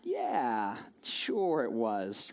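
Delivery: low, slow, dry sarcasm; a slow, deadpan sure it was; sarcastic wife. Retold or imitated. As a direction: drawl it out, unconvinced. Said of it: "she's like".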